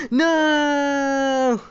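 long, high "no" scream; dismayed scream. Real, not acted. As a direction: yell out a drawn-out no in dismay. Falls while rushing in the game.

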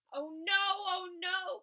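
a frightened oh no; buffalo crying out. A narrator's reading. Buffalo frightened of the elephant, crying out oh no. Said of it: "cried the buffalo".

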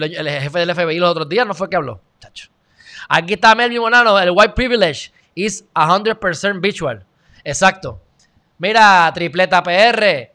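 ordinary talk, nothing marked

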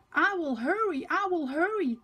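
high, eager promise; eager Thomas. A narrator's reading. Thomas promising Sir Topham Hatt to hurry. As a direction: promise it brightly, keen to go. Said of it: "Thomas says".

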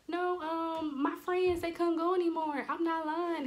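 high-pitched insistent fibbing; pleading excuse. Retold or imitated. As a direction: make excuses to an angry parent. Pleading excuse to angry dad.

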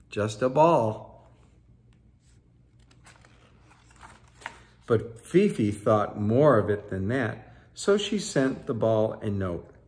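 put-on lively read-aloud for children; story narration. A narrator's reading. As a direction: tell it like a bedtime picture book. Reading picture book aloud.

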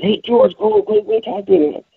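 high-pitched mock Elaine, egging him on; imitating Elaine. Retelling Elaine urging George on.

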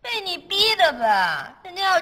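high, loud, drawn-out reproach; slow, accusing wail; blaming outburst. Acted. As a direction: throw the blame at them, stretching it out. Blaming someone for the breakdown.

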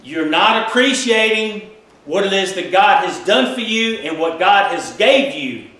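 usual speaking voice

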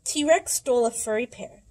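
ordinary delivery

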